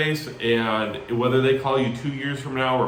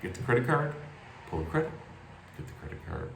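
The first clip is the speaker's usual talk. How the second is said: fading refrain; 7 semitones lower, 12 dB quieter, much faster than usual. Shown as a demonstration.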